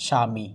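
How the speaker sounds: স্বামী said "sha-mi", b silent; pronunciation demo. Shown as a demonstration. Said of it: "এখানে উচ্চারণ হয়ে যাচ্ছে".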